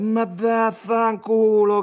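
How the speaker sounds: drawn-out, high, loud sing-song curse; loud mocking singsong; sing-song jeer. A narrator's reading. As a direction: chant the insult, stretching every vowel. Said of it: "cantò Simmio".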